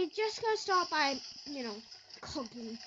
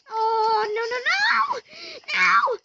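loud, much higher screamed no's; real meltdown over the game; frantic shouting; 7 semitones higher, 12 dB louder than usual. Real, not acted. Frantic while losing level.

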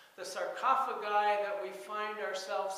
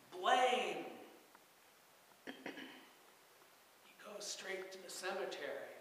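ordinary talk, nothing marked

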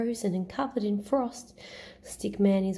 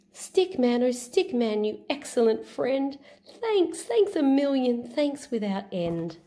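grateful Santa voice; 4 semitones higher than usual. Acted. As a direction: gush your thanks to a friend. Santa thanks him.

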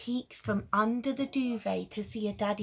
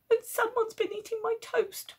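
put-on much higher, childish complaint; whiny child; 12 semitones higher than usual. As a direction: whine it like a small kid. Little person complains.

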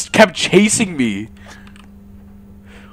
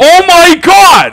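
startled shout; 11 semitones higher, 7 dB louder than usual. Real, not acted. Monster suddenly appears.

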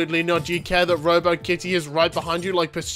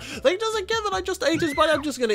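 mock high voice; 11 semitones higher than usual. Voicing indifferent diner.

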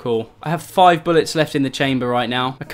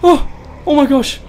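short, high, loud cries; panicked yelps; 12 semitones higher, 5 dB louder, slower than usual. Real, not acted. Player escaping the zombie, panicked yelps. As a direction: yelp your way out of danger.

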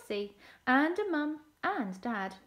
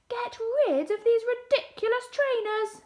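put-on high, loud, indignant child's yell; shrieked demand from a little sister; 13 semitones higher, 9 dB louder than usual. A narrator's reading. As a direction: yell it as an outraged complaint. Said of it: "yelled Elsie".

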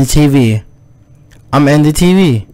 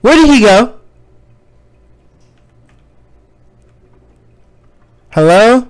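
calling out; 10 semitones higher than usual. Real, not acted. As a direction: call across the room. Calling out to figures.

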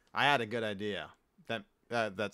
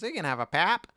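lightly imitated Toledo accent, "pap" higher; 7 semitones higher, 4 dB louder than usual. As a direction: mimic a friend's Toledo accent, "pap" with a flat a. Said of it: "With her Toledo accent".

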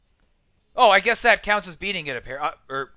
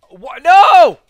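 loud, high cry of protest; startled yell at a game mishap; dismayed shout; 8 semitones higher, 15 dB louder than usual. Real, not acted. Something goes wrong in game.

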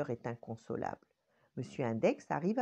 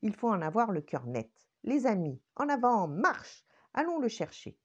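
higher, louder marching command; a hint of a little general rallying troops; rallying thumb; 6 semitones higher, 4 dB louder than usual. Monsieur Pouce rallies the fingers, lightly bossy.

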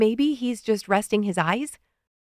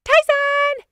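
high, drawn-out call upstairs; high-pitched calling shout; 4 dB louder than usual. A narrator's reading. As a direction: holler the name up the stairs. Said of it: "she turns toward the stairs and shouts".

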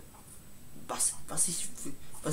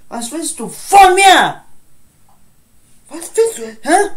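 furious yelling; 9 semitones higher, 15 dB louder than usual. Acted. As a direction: demand an answer in rage. Shouting in fight. (wütend und langsam gebrüllt)

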